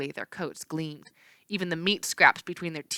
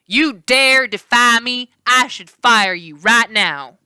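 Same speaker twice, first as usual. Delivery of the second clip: angry villain; 5 semitones higher, 8 dB louder, slower than usual. Acted. Villain outraged by defiance.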